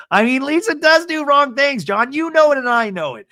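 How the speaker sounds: high, loud wail; mock exasperated protest. Host correcting John about Lisa, in a high mock protest. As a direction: plead loudly as if correcting John.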